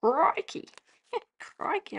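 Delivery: Crikey with a broad Australian twang; mock Australian outback crikey. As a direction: put on an Aussie exclamation. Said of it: "That's a very Australian thing to say".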